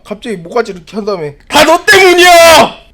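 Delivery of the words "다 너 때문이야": high, loud, drawn-out yell; sudden furious shout. Acted. Silent man blaming the other, sudden furious shout. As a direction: explode in acted rage. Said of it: "갑자기 분노로 바뀌죠".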